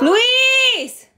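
very high yell of a name; yelling for her brother to stop; calling out. Real, not acted. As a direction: call him sharply across the room.

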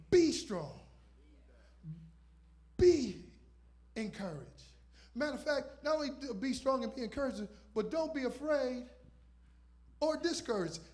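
rising preacher cadence, punched opening; preaching. Acted. Preacher exhorting the congregation, rising fervour. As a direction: call it out to the church.

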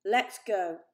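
loud command. A narrator's reading. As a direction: order them out. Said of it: "He turned to face the family".

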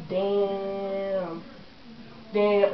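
low, hushed reaction; drawn-out, quiet disbelief; stunned. Retold or imitated. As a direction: let it sink in, under your breath. Acts out stunned reaction to fall.

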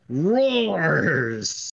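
long, slow narrated roar; drawn-out roar. A narrator's reading. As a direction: stretch the word out slowly. Game master draws out the roar.

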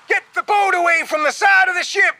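very high urgent yell; shouted command. A narrator's reading. Captain to rowers beside the sinking ship, shouting. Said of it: "shouted the captain".

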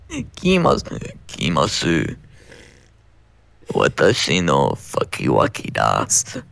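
very low, deep growl of a voice. Pretend. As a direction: drop the voice as deep as it goes. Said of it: "deep hentai voice".

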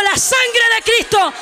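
slow, ringing preacher's rebuke; shouted rebuke. Retold or imitated. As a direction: cry it out to drive off evil. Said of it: "empecé a reprender".

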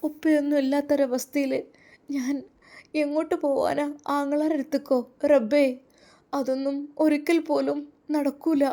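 high-pitched, plaintive voice; acted weeping despair; tearful lament. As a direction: plead in distress, pitched well up. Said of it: "Shebina തേങ്ങി കരയുകയായിരുന്നു".

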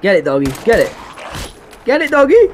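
building to a high loud shout; urging cheer. Real, not acted. Gamer urging his dog companion on, rising excited shout.

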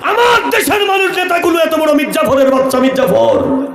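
high, shouted preacher's outrage, easing down at the end; outraged denunciation. Acted. Prophet denouncing his country's treacherous leaders, outraged high shout. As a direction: cry out against the traitors.

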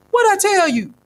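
high, loud exclaim; old man proud of his wisdom; eager old man. A narrator's reading. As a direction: pounce on the point with glee.

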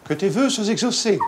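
high, sweet fairy granting wishes. Pretend. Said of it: "Imite-moi une fée".

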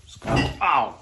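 sharp loud yelp of real pain; sudden pain. Real, not acted. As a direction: cry out as something hurts you. Hurts himself mid-sentence while cleaning.

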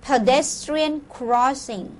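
slow, drawn-out "Pedestrian crossing." with stress on "Pedestrian"; model pronunciation. Shown as a demonstration. Teacher modelling "Pedestrian crossing." for students to repeat. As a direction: say "Pedestrian crossing." slowly for the class to echo. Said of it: "ออกเสียงพร้อมๆ กัน".

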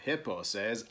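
ordinary delivery, nothing marked

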